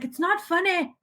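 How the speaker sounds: put-on high-pitched child's Scottish accent; little Scottish girl. Quoting girl drawn on by Elf, high mock Scottish accent. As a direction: imitate an upset wee Scottish girl. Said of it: "it's the little Scottish girl".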